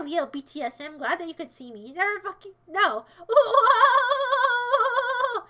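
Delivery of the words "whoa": long, high, loud whoa; big surprised whoa; loud startled whoa. Real, not acted. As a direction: shout out in surprise.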